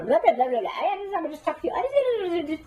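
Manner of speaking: high-pitched babble, running on and on; nonstop chatterbox. Retold or imitated. Imitating a talker who wears out listeners, high nonstop chatter. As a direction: mimic someone talking your ear off. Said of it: "we can talk people's ears off".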